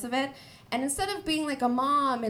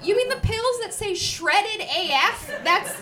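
high, louder disbelief; incredulous; 7 semitones higher, 5 dB louder than usual. Retold or imitated. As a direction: sound baffled at the pill name. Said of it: "I was like".

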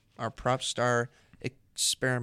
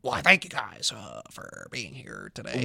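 bad impression of a late-night host; Johnny Carson. Host attempting requested impression, halting talk-show voice. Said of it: "Johnny Carson".

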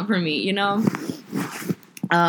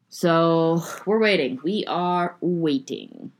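slow drawn-out; 2 semitones higher, slower than usual. Real, not acted. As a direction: stretch the words slowly. Drawn-out waiting.

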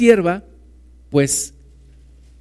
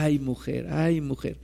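weary sigh; 2 semitones lower, 10 dB quieter than usual. Retold or imitated. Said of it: "un amigo que le decía a su esposa".

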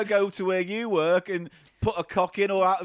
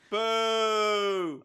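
mock heckle; 6 semitones higher than usual.

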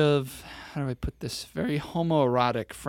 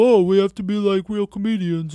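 higher, louder snooty mimicry; mocking pompous quote; 6 semitones higher, 4 dB louder than usual. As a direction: sneer it like a self-important comic. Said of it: "here you are like".

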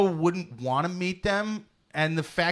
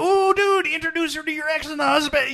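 a giddy buddy cheering on a bad plan, much higher; eager egging on; 11 semitones higher than usual. To a guy online, urging him on in gleeful excitement. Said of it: "I'd be like".

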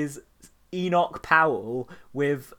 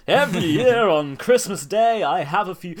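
showbiz guy; 5 semitones higher, 7 dB louder than usual. Retold or imitated. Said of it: "some shit-eating showbiz guy".

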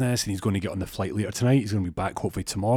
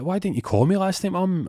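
high-pitched voice of a son; high, put-on kid-to-mum complaint; complaining son; 11 semitones higher, 3 dB louder than usual. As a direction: pitch it right up, a son quizzing his mum. Voices son questioning his mum.

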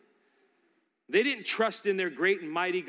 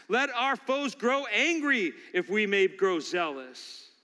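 Charles Spurgeon; 6 semitones higher, 5 dB louder than usual. Retold or imitated. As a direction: thunder it from the pulpit. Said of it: "hear the voice of the men of old, like Charles Spurgeon".

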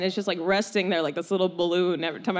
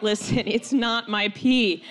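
slow, emphatic plea to be believed; slow confession; much slower than usual. Retold or imitated. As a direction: spell it out very slowly, word by word. Confessing to disbelieving parents.

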